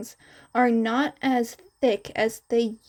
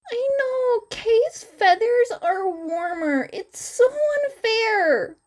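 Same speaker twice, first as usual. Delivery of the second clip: much higher, slow whining voice; whiny complaint; 11 semitones higher than usual. A narrator's reading. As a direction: whine like a sulky kid, pitched way up. Izuku whining about feathers.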